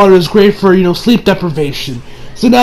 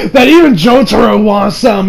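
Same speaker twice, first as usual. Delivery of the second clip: ordinary delivery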